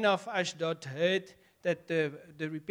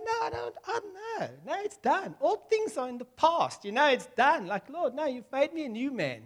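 high pleading protest; 6 semitones higher than usual. Acted. Believer pleading, voice raised high.

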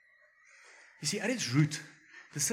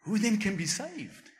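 astonished disciples; 6 semitones higher, 3 dB louder than usual. Retold or imitated. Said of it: "And they say to him".

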